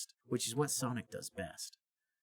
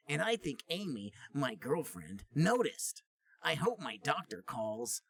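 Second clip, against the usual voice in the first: higher, mock-prim reading voice; teacher reading aloud; 6 semitones higher than usual. Teacher reading Sonic's journal to the class, higher mock voice. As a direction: read the diary out in the teacher's voice. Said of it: "She began to read".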